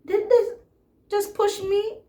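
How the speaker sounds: put-on outraged that the boy pushed her, sky-high and loud; incredulous. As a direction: squeal it in shocked disbelief. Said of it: "I'm like".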